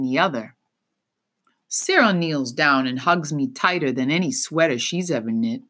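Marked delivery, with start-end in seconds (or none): none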